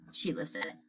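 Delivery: plain reading voice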